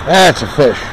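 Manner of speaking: usual speaking voice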